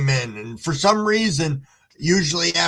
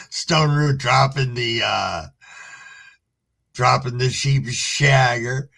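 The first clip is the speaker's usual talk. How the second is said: ordinary talk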